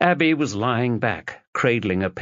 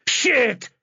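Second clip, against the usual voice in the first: high sharp outburst; alarmed curse. Acted. Husband realising the danger, a sharp high curse. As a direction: spit it out in shock. Realises she was poisoned.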